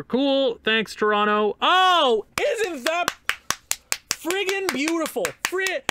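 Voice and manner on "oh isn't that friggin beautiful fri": sarcastic delight. Real, not acted. Sarcastic praise after loss.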